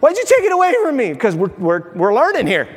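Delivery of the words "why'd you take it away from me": high, fast, mock-whining baby; whiny child. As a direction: play the outraged toddler. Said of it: "they're like".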